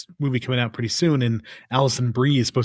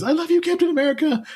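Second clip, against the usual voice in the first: much higher, dreamy German-free gush, imitating the wife; swooning wife. Husband mimicking his wife's crush on Captain America, high swoon. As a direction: sigh it out like a smitten fan. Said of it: "she always goes".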